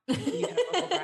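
laughing tease. Real, not acted. Teasing friend about her voice.

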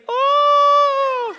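high, loud cry of amazement; a huge astonished yelp; amazed cry. Shown as a demonstration. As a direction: burst out in shock. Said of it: "Which literally translates".

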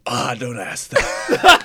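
slow, lightly put-on British sigh; a mock British grumble, drawn out; British ringtone voice. Friend mimics a gloomy British dad as a ringtone, slow mock British. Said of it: "I could just play a British voice going".

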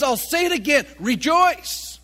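"rejoice" pitched high and loud; emphatic repetition. Shown as a demonstration. Preacher repeating Paul's command to church, "rejoice" high and emphatic. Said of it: "He's trying to emphasize this".

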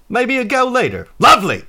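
high, loud, hearty exclamation; Quint's character voice. Acted. Quint sizing up Warble up close, loud and pleased. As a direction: sing out approval at full volume. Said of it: "He's like".

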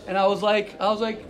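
usual speaking voice

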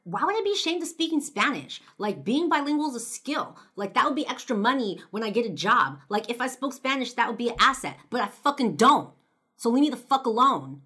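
snapping back. Retold or imitated. Girl snapping at the stranger to leave her alone, loud fury. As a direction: finally snap and tell him off. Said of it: "finally I snapped back".

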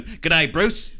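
high, loud mock Australian greeting; Australian accent. Quoting an old Monty Python skit to a co-host, loud Australian accent. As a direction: belt it out in a broad Australian accent. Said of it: "where they're all Australian just going".

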